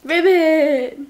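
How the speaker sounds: loud, high croaking ribbit; frog ribbit. Shown as a demonstration. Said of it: "What's a frog say?".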